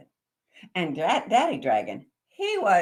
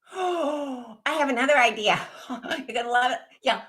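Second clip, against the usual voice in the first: excited idea; 5 semitones higher, 5 dB louder than usual. Acted. Dragon with new idea.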